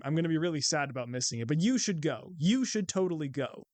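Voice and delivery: ordinary talk, nothing marked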